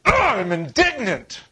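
high, slow huffy Ugh, I'm indignant. Shown as a demonstration. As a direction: huff out the words with a high offended sigh. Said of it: "That's what it looks like".

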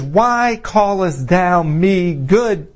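slow, deliberate quoted question; measured rebuke. Retold or imitated. Preacher quoting Jesus to the congregation, measured rebuke. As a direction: stretch the words out pointedly.

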